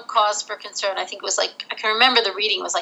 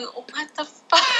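stunned swear; 6 semitones higher, slower than usual. Retold or imitated. Shocked alone in car.

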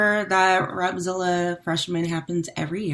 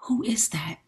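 retold whisper; 5 semitones higher, 6 dB quieter than usual. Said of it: "I whispered to him".

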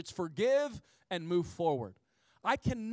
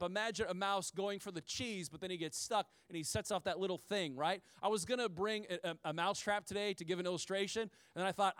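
ordinary talk

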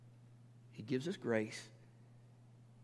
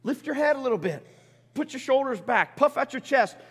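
encouraging Jesus; 8 semitones higher, 7 dB louder than usual. Acted. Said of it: "He says".

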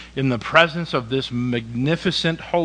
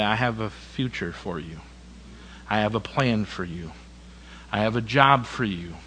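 tender Jesus; 3 semitones lower, 7 dB quieter than usual. Acted.

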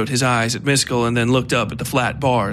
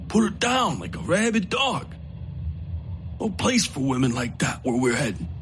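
coaxing outlaw; 6 dB quieter than usual. Acted. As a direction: tempt him to kill. Coakley urges murder.

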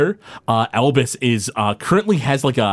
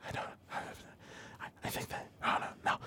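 muttered aside; 24 dB quieter than usual. Real, not acted. GM muttering to himself.

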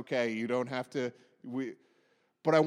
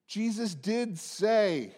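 ordinary talk, nothing marked

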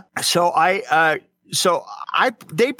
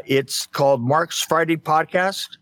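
normal talking voice

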